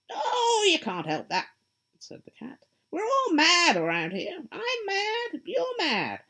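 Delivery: high, loud, sly grinning cat voice; a teasing cat, pleased with itself; Cheshire Cat. Acted. The cat answering lost Alice, high and sly.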